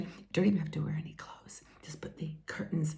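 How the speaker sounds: cheeky unvoiced whisper. Real, not acted. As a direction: whisper it like a naughty secret. Cheeky aside about clothes.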